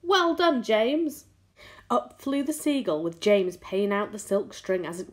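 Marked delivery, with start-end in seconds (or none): 0.0-1.3 high, loud congratulation; shouted praise. A narrator's reading. Grasshopper praising James from the tunnel, shouting. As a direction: call out praise, pitched up. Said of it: "shouted the old green grasshopper".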